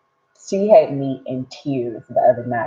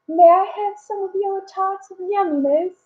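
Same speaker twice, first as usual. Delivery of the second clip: high, put-on posh British accent, asking sweetly; a child's mock British accent begging for a treat. Daughter teasing mom in British accent, high-pitched. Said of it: "in a British accent".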